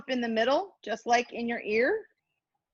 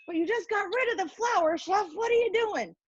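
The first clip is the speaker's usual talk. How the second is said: mock challenge; 7 semitones higher than usual. Voices viewer challenging the chef.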